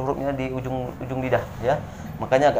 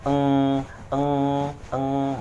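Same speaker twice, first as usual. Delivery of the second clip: nasal eng hum; much slower than usual. Shown as a demonstration. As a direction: hum eng three times. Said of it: "N G". (contoh bunyi dengung eng)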